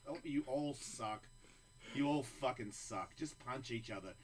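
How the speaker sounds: ordinary talk, nothing marked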